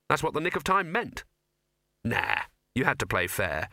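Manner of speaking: usual speaking voice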